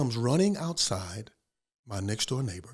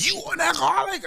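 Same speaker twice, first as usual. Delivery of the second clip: incredulous neighbor; faster than usual. Retold or imitated. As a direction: ask it like you can't believe a preacher drank. Neighbor surprised by confession.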